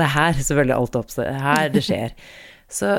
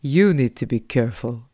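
lower, blank echo of the warning; parroting driver; 4 semitones lower than usual. Retold or imitated. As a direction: mimic the driver repeating it dumbly. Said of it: "Og han bare".

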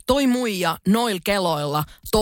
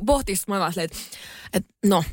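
normal talking voice

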